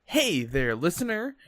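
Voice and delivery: overeager infomercial host; cheesy ad voice. Pretend. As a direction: sell it like a cheap commercial. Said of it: "enjoyed that just beautiful ad".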